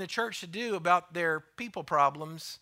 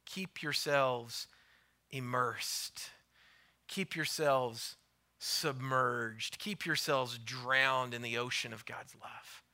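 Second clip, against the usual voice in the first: soft slow refrain; 5 semitones lower, 4 dB quieter, slower than usual. Real, not acted.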